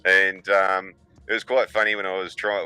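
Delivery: ordinary talk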